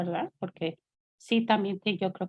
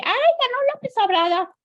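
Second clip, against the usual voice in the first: much higher, louder and fast, delighted; happy exclaim; 7 dB louder, faster than usual. Retold or imitated. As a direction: blurt the news overjoyed. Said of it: "yo bien contenta le dije". (exclamación alegre, mucho más aguda y fuerte)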